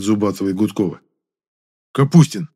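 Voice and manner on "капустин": loud barked name; growled bark. A narrator's reading. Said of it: "рыкнул Зубатов". (рявкнуть)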